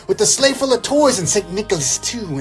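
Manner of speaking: ordinary talk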